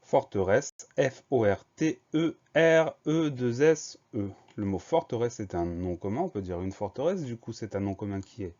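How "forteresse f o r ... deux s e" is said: slow spelling dictation. Shown as a demonstration. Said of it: "on fait attention".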